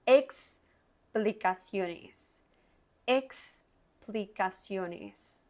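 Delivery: explicaciones stretched out slowly, the ex clear; slow, careful teacher model; slow syllable drill. Shown as a demonstration. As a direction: spell explicaciones out slowly for a learner. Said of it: "Es muy importante la ex".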